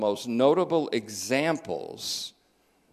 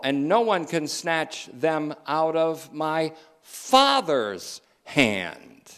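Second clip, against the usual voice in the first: slow, louder scripture proclamation; emphatic preaching; 4 semitones higher, 5 dB louder, slower than usual. Real, not acted. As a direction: weigh out each word, building to the key one.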